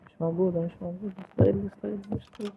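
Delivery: hushed high whisper. Real, not acted. Hushed hiding from granny.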